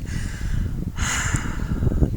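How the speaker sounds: long, soft voiceless sigh; long breath out. A narrator's reading. Narrator acts out letting out a long breath, soft sigh. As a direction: let out a long, quiet exhale. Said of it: "I let out a long breath".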